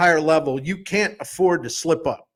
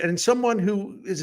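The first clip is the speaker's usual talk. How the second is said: ordinary talk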